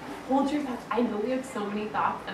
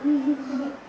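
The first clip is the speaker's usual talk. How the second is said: amused laugh; 3 semitones higher than usual. Real, not acted. Laughs after the rhyme.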